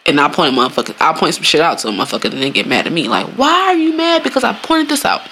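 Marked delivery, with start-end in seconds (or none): 3.3-5.2 exasperated high voice. Acted. Exasperated mock quote.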